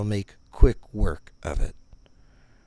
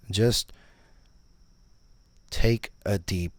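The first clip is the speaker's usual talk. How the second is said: slow, deliberate, spaced-out words; pointedly slow instruction; slower than usual. Pretend. Meditation guide to a pupil who keeps failing, pointedly slow. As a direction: spell it out slowly for a failing pupil. Repeats instruction after failed attempts.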